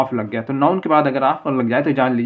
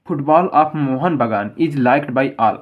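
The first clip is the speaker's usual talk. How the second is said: slow example reading; much slower than usual. Shown as a demonstration. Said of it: "अब दूसरा उदाहरण".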